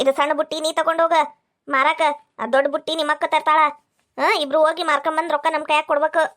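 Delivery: ordinary talk, nothing marked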